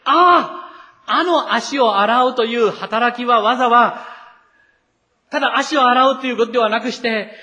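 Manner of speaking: high, loud, excited voicing of the disciples' sudden insight; dawning realization. Preacher voicing the disciples finally understanding, excited and loud. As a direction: let it dawn on them all at once, loud and high.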